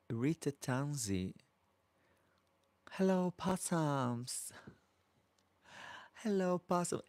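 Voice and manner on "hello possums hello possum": Dame Edna. Retold or imitated. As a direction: croon it like a theatrical grande dame. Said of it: "Dame Edna lookalike".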